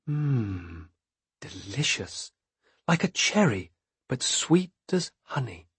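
hushed and savoring; savoring softly. A narrator's reading. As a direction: murmur it like tasting sweets.

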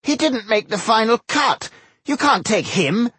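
high, insistent dwarf voice; aghast protest. A narrator's reading. Dwarf protests to Tungdil about his choice, dismayed. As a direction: object in high-pitched dismay.

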